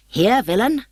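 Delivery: high, challenging mock-Demetrius; lightly voiced imitation of a swordsman; mimicking Demetrius. A narrator's reading. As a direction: fake a rival's defiance. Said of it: "answered Puck in Demetrius's voice".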